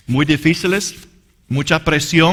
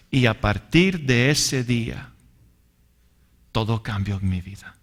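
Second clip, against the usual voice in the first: tender testimony; 4 semitones lower, 3 dB quieter than usual. Real, not acted. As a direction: let it settle gently, like relief. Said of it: "tender testimony of peace". (testimonio suave y sereno)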